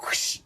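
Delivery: soft rush of air; imitated whoosh. Describing flying into something, imitated whoosh. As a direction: blow out a short whooshing noise. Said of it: "and I felt like".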